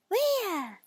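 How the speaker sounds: high squeaky pig voice; eager little pig. Acted. Pig asks.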